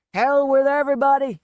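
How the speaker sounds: put-on a loud drunken roar; shouted. A narrator's reading. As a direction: bellow it out drunk. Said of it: "he shouted".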